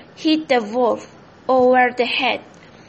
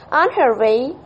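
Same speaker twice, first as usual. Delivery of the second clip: ordinary delivery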